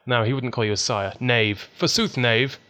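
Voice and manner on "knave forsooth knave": loud hammed-up Victorian threat; hammy stage actor. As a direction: ham it up like an old stage villain. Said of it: "my Victorian patter".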